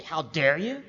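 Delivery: lightly voiced rebuke; mock scolding. Preacher imagines Jesus scolding Thomas, mock rebuke. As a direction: act out a mild scolding. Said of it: "scold Thomas and say".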